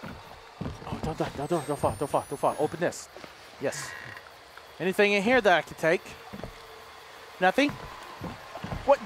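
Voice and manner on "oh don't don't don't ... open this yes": tense whisper. Real, not acted. Tense platforming moment.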